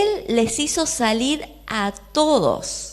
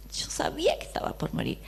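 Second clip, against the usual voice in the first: whisper; 6 semitones higher, 9 dB quieter, faster than usual. Retold or imitated. Jairo's despair, voiced as father.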